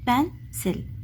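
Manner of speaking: Pencil split in two, "pen", pause, "sil"; split into syllables. Shown as a demonstration. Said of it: "word को हमने टू parts में कर दिया". (Pencil को दो हिस्सों में तोड़कर: पेन - सिल)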